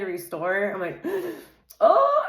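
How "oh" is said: sharp gasp, then a very high, loud oh; gasp of surprise. Real, not acted. Reader startled by a card, very high, loud gasp. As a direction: caught off guard by the card.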